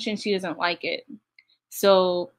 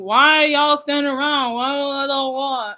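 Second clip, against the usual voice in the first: mimicking him; 5 semitones higher, 4 dB louder than usual. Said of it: "and kicked her out and saying".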